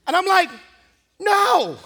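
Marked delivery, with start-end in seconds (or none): 1.2-1.8 grumpy refusal. Retold or imitated. Grumpy refusal to antsy assistant.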